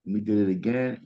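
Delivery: normal talking voice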